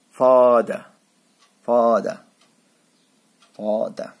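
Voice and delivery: फादर with an open long aa in the first syllable; long aa sound. Shown as a demonstration. Teacher repeating फादर for students, open long aa. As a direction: stretch the aa in फादर. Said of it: "बनता है आ".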